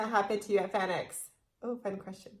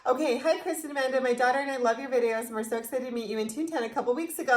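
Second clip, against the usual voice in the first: normal talking voice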